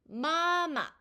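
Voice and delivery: 마마 with a high held first syllable, short drop on the second; high level 마, then brief falling 마; first tone plus neutral tone. Shown as a demonstration. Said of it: "이렇게 소리가 납니다".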